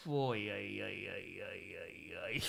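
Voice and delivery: low, fading string of ay-ay-ay; real groaning dismay, trailing down; dismayed muttering. Real, not acted. Dismayed reaction to home run.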